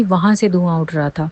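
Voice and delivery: ordinary talk, nothing marked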